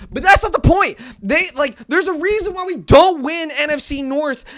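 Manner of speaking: loud, high-pitched protest; worked-up fan's tirade; angry rant. Real, not acted. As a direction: shout it in real exasperation. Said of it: "the whole entire rant".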